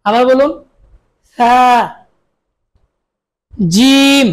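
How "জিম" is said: জিম said forcefully and a bit louder; firm jim. Shown as a demonstration. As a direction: punch জিম firmly. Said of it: "শক্তভাবে". (জিম শক্তভাবে উচ্চারণ)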